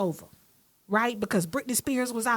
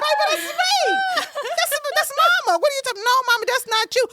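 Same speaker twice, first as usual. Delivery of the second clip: much higher, louder, laughing exclamation; laughing protest; 5 dB louder, faster than usual. Retold or imitated. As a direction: insist to your kids it's you. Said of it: "I said".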